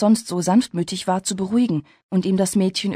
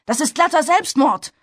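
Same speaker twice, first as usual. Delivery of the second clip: raised, urgent warning; shout; 8 semitones higher, 4 dB louder than usual. A narrator's reading. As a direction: call out to stop her, pitched up. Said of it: "schrie Travis". (eindringlicher Ruf)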